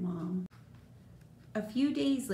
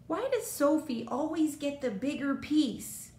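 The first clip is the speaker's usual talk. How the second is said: higher-pitched jealous protest; understated storybook outburst of unfairness; mild yell; 5 semitones higher, 3 dB louder than usual. A narrator's reading. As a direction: complain like an aggrieved kid, only mildly yelled. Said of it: "yelled Sammy".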